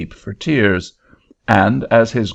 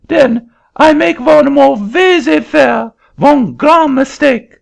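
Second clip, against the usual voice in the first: put-on very high, loud French-accented protest; an indignant Frenchman in a shrill-high French accent; angry French accent; 7 dB louder than usual. Frenchman refusing the auctioneer's seat, high loud French accent.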